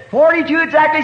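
a high, surprised 'exactly?' as the guide; surprised question. Retold or imitated. Guide doubts the prophecy.

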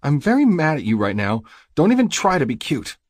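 high, loud cross woman's voice; high-pitched indignant scolding; angry mate. A narrator's reading. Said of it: "She swipes angrily at her cheeks".

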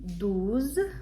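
DOUZE with an "oo" vowel. Shown as a demonstration. Teacher explaining OU, DOUZE with oo. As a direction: say DOUZE as "dooz", rising at the end. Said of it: "О, Ю читається як У".

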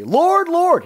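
loud, high imitation of eager believers; hammed-up pious call; emphatic and excited. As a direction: cry it out high, with showy zeal. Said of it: "They're emphatic. They're excited".

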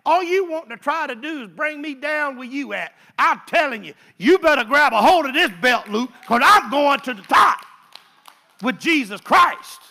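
loud, high preaching shout; full-force preaching. Acted. Preacher rallying the church, shouting high and loud.

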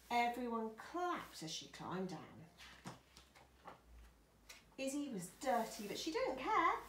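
lively picture-book climax; story narration. Acted. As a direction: build the drama for small children. Reads the climax to children.

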